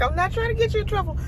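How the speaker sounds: high fast protesting plea; mock plea. Telling the driver, high fast mock protest. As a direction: rush it out high and defensive. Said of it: "I'm like".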